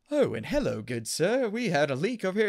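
lightly imitated British accent; mock British accent. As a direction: put on a mock-British lilt. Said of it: "hear British accents constantly".

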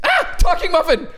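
mock scream. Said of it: "the second one screams".

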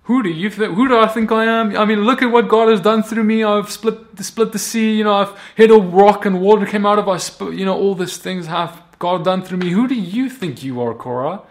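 high-pitched, loud self-justifying rant; indignant boasting. Acted. Moses hypothetically rebuking Korah, boastful and indignant. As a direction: pull rank with rising indignation. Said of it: "he could have been like".

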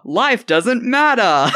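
slow, high melodramatic moan; high dramatic wail. Pretend. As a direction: stretch it out high and mournful. Mock album title read.

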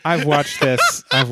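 words breaking up with real laughter; laughing in disbelief. Real, not acted. Cohost laughing at a fact he never knew, laughing. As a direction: laugh through it, can't believe it.

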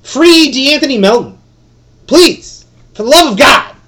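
loud, high pleading shout; fired-up plea for a player; worked-up plea. Real, not acted. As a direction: beg at the top of your voice. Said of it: "I'm getting worked up here".

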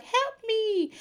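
high-pitched pleading cry for help; mock desperate plea. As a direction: squeak it out like someone begging to be saved. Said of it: "not crying in a way of, like".

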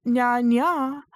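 high playful cat nya; cutesy nya speak. Pretend. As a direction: mew it like an anime cat. Cat sound for fun.

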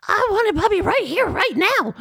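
high squeaky demanding pigeon; whiny baby animal wanting a puppy. Shown as a demonstration. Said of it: "It's like".

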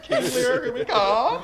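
high, laughing rallying cry; mock heroic declaration, laughing; laughing mock triumph. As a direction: announce it grandly through laughter. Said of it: "And I was like".

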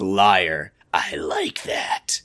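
low, very slow whisper; hushed, drawn-out mock menace; creepy hush. Acting out character's creepy reply.

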